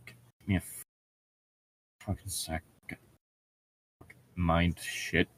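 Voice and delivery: usual speaking voice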